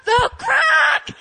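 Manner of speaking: very high, loud, half crying; desperate yelling. Retold or imitated. Correcting her friend, a high desperate shout. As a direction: insist on the crack.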